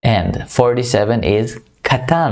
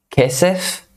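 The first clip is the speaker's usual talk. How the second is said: Kesef said higher with a rising end; higher rising model; 7 semitones higher than usual. Shown as a demonstration. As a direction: raise the pitch on Kesef. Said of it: "means money or silver".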